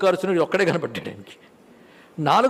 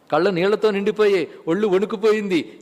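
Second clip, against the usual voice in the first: usual speaking voice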